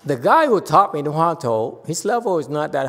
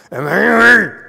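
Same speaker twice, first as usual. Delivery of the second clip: loud, high acted burst of anger; angry outburst; 7 semitones higher, 8 dB louder than usual. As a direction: let it explode at the end of the mantra.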